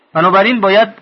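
ordinary delivery

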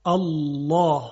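الله with a thick, velarized double lam; heavy lam in Allah. Shown as a demonstration. Teacher demonstrating the heavy lam of الله. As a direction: say الله with a full, dark lam.

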